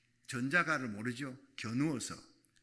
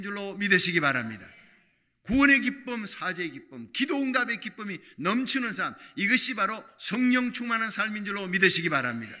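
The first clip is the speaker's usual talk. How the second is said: loud, high-pitched sermon cadence; fiery preaching; 6 semitones higher, 9 dB louder than usual. Acted. Pastor urging the congregation, loud fiery preaching. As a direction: preach it with full force.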